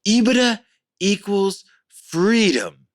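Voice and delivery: high, slow mock-solemn delivery, FREEDOM drawn out; weighty quoted pronouncement. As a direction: imitate a mentor spacing out "ebitda ... equals ... freedom". Quoting the English mentor's key advice.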